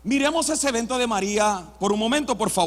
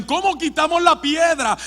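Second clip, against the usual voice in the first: raised, louder acted question; urgent question; 5 semitones higher, 5 dB louder than usual. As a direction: call it out as an urgent problem. Mujeres preocupadas ante la piedra. (pregunta urgente en voz alta)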